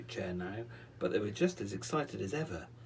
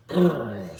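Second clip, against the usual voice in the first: angry wizard brrr; 12 dB louder than usual. Acted. Wizard grunts.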